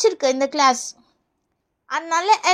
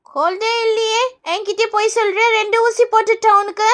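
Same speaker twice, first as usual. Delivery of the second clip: ordinary talk, nothing marked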